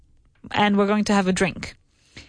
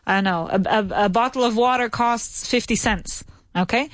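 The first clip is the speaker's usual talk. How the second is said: ordinary talk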